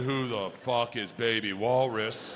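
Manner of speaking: low, drawn-out demand; slow demand. Retold or imitated. Dad confronts his daughters at the table, slow demand. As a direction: ask it slowly, deep in the voice.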